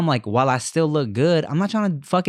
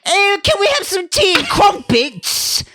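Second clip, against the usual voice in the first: much higher, mock-posh British voice; posh British parody; 4 dB louder than usual. Mocking stuffy Brits, high posh British voice. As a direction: squeak it like a stuffy Brit. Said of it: "before I just go".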